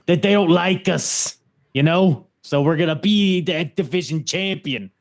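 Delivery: weak New Jersey wrestler voice; New Jersey accent. Retold or imitated. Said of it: "I can't do a New Jersey accent".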